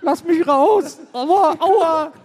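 high, loud pained squeal; trapped angel yelling. Acted. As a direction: squeal out in mock pain.

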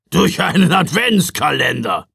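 loud blustering repeat. A narrator's reading. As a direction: bluster it back at them. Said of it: "polterte Knecht Ruprecht". (Ruprecht poltert laut zurück)

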